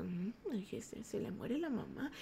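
murmullo mucho más suave, imitando al taxista; taxista murmurando. Retold or imitated. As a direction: susurrar el chisme como el taxista que escucha. El taxista chismoso. (el taxista murmurando el chisme, muy bajito)

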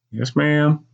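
put-on high-pitched child's reply; obedient boy. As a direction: answer mother innocently in a high voice. Boy obediently answers mother.

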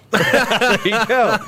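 slow high laughing reply. Real, not acted. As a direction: laugh the words out. Laughing at guest answer.

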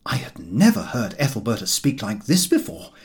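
ordinary delivery, nothing marked

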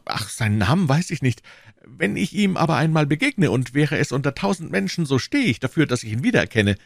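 high, earnest shopkeeper voice; eager anxious prisoner. Acted. Voices Bonacieux confessing.

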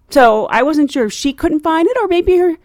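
usual speaking voice

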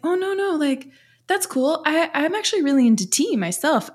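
high, eager imitation of him; high-pitched quote. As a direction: lift the voice way up, eager. Husband's reply acted out.

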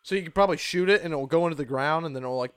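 normal talking voice

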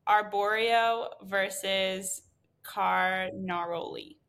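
slow, careful stab at Arborio and Carnaroli; unsure pronunciation. Real, not acted. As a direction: sound out Arborio and Carnaroli slowly, unsure of them. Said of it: "I don't technically totally know how to pronounce these".

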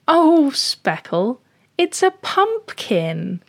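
high, slow, indulgent lady cat; amused neighbour cat. Acted. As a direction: gently explain to a silly kitten, drawn out and high. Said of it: "starts laughing ... And she goes".

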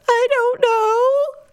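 put-on high, slow little kid voice; child voice. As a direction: play a clueless toddler. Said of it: "three-year-old answering".

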